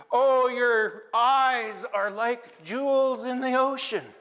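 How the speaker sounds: high, slow gushing compliments; butter up the crab to save your life; desperate flattery. Acted.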